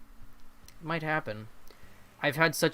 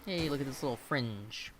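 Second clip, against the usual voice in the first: usual speaking voice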